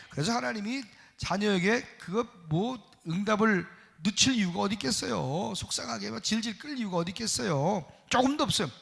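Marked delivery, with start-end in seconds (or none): none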